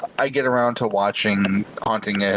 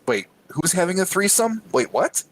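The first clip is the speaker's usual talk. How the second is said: higher-pitched, mock-baffled double take; startled double take; 9 semitones higher than usual. Retelling his reaction to the trailer, startled double take. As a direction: replay your disbelief at what you just saw.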